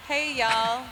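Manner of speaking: a light stab at a Southern drawl; attempted Southern accent. Shown as a demonstration. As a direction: try on a Southern accent, half-committed. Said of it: "Vi, do your, uh, Southern accent".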